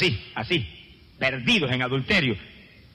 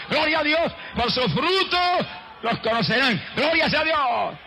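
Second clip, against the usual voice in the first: climbing fervent sermon voice that ends in shouts; full-force preaching; 7 semitones higher than usual. Acted. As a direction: preach it with rising fire to the congregation. Preaching climax, rising intensity.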